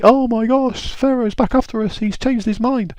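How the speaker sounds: very high squeaky panic; a high squeal of alarm at being chased; panicked Israelites. Acted. Israelites panic seeing Pharaoh.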